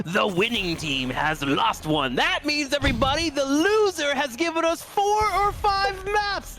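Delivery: high, slow over-the-top announcer; mock hype caster. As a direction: ham up the good news like a caster. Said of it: "It's just so funny how you present it".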